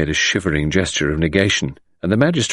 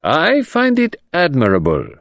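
imperturbable; 10 semitones higher than usual. Acted. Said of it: "said the other imperturbably".

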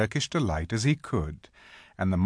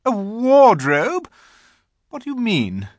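incredulous queen; 13 semitones higher, 8 dB louder than usual. A narrator's reading. The Queen hears of a wardrobe, incredulous. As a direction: query it haughtily in disbelief.

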